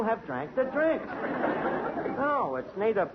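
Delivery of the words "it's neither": ordinary talk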